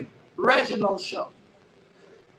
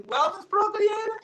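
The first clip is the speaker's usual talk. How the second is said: high rising disbelief on "Wellness Pro Creator"; acted incredulous question; 10 semitones higher than usual. Doubts the name offered to him.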